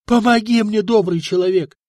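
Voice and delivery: desperate begging; frantic pleading. A narrator's reading. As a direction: beg with wild hope. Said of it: "С неистовой надеждой взмолился".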